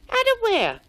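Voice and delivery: high, loud call. A narrator's reading. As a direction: call up toward the voice. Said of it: "called Spotty".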